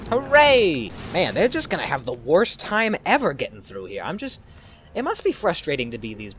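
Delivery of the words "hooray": high, loud hooray; celebratory shout, pitch falling away; loud cheer. Real, not acted. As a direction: cheer the little victory. Cheering a game success.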